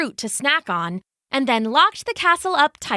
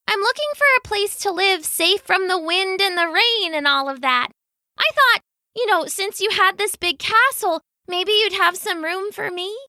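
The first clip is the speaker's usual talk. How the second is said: timid louse; 10 semitones higher than usual. Acted. Louse asks for shelter.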